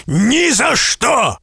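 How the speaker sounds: very slow, high, hard refusal; giant's flat refusal. A narrator's reading. Giant refusing to spare the merchant, slow harsh refusal. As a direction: refuse him with finality. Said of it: "сказал великан".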